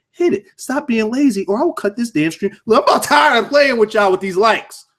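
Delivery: high, worked-up telling-off; mock scolding. Real, not acted. Streamer nagging lazy viewers to hit like, mock exasperated scolding.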